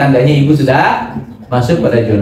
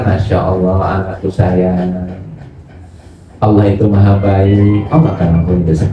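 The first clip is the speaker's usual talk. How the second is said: smiling, gentle; 8 semitones lower than usual. Acted. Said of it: "Dengan tersenyum".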